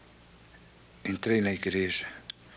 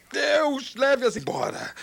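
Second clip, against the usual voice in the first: high, loud cry to God; desperate loud plea; 4 dB louder, slower than usual. Retold or imitated. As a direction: plead aloud, eyes shut, on one foot. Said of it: "Eu disse". (súplica alta e aguda)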